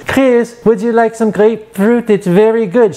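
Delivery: high, loving mother calling her son; mom's voice. Retold or imitated. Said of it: "She was a French woman".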